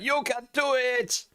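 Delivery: slow, high cheering squeak; high encouraging voice. Pretend. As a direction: cheer yourself on in falsetto. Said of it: "self encouragement".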